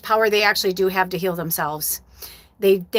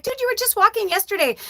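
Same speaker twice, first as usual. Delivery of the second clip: high, incredulous protest; retold disbelief; 13 semitones higher than usual. Owner to her cat after it stopped walking, high-pitched disbelief. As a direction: voice jumps up in mock disbelief.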